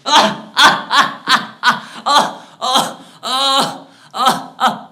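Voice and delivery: loud, high, staccato mocking laugh; triumphant sarcastic cackle. Conscience mocks the exhausted man, cackling triumphantly. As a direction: cackle in loud bursts of gloating. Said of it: "burst into a cackle of triumphant and sarcastic laughter".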